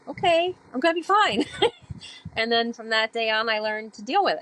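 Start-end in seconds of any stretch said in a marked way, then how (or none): 0.1-1.5 high, bright relieved resolve; relieved self-assurance. Retold or imitated. Reassured by her mentor, high and relieved. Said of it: "And I'm like". 1.5-2.3 bright little laugh of relief; high relieved laugh. Real, not acted. After recalling her mentor's words, a relieved laugh.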